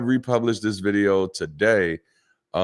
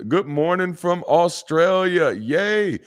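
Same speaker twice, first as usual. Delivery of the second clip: cheery mock greeting; 10 semitones higher, slower than usual. Imitating chat greeting cheerfully.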